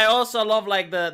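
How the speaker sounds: ordinary talk, nothing marked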